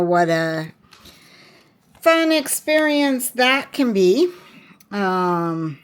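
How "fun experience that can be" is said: higher-pitched mock enthusiasm; sarcastically upbeat, as if it were a treat; sarcastic. Real, not acted. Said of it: "I'm being very sarcastic about that".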